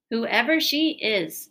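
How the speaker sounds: loud and slow, settling it; firmly. A narrator's reading. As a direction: lay it down as final. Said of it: "said the king firmly".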